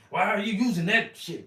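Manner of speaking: high, loud incredulous yell; teacher's scandalised high shout; mock teacher yell. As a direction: bark it like an outraged art teacher. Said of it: "yell at me for using Winton".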